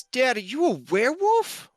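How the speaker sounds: put-on high, small child voice; kid voice. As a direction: play the little boy asking. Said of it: "Frankie says".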